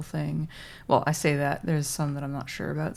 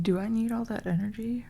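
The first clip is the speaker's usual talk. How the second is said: ordinary delivery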